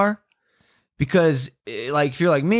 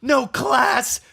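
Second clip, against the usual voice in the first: put-on outraged old lady; 9 semitones higher than usual. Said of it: "she goes".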